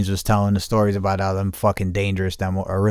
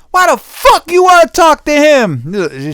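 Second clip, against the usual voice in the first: much higher and louder, slow shrieking mock fury; imitating angry woman; 10 dB louder, slower than usual. Mocking the woman refusing the husband, shrieking high. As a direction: squeal it like the enraged lady. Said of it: "She said".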